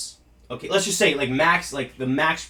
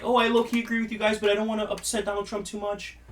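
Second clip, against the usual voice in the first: mocking conflicted fan; 5 semitones higher, 6 dB quieter than usual.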